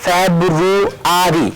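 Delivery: each syllable drawn out slowly; slow syllable demo. Shown as a demonstration. Teacher drawing out a word for the class, slowly. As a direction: stretch the word slowly for students. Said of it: "আমরা লিখতে পারি".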